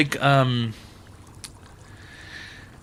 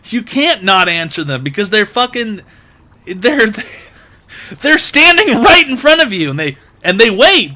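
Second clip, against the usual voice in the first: higher, louder, building frustration; exasperated rant; 7 semitones higher, 5 dB louder than usual. Acted. Comedian rants about waiting cashiers, exasperated. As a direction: rant like you can't believe it.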